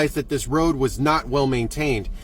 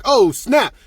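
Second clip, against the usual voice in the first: loud, raised excited exclamation; acted sudden delight; 5 semitones higher, 5 dB louder than usual. Driver slowing on the hill, mock-excited outburst. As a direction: pop it out with happy relief. Retold delight at regaining control.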